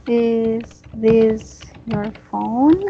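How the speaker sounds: slow, careful model question with a rising end on phone. Shown as a demonstration. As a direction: say it slowly, lifting phone at the end. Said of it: "good job".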